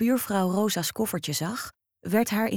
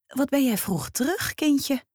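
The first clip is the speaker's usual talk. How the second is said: put-on nosy old neighbour; 8 semitones higher than usual. Old neighbour greets Rosa.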